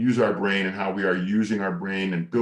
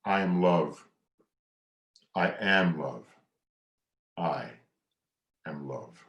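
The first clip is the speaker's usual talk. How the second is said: mantra; 4 semitones lower, slower than usual. Shown as a demonstration. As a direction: breathe the words out. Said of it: "I follow that by saying".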